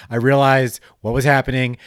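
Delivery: ordinary talk, nothing marked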